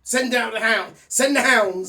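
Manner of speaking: mock command.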